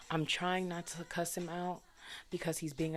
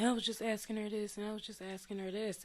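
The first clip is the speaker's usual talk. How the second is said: higher-pitched mock-defensive excuse; mocking imitation; 5 semitones higher than usual. Daughter mimics his excuse to mom, mock defensive.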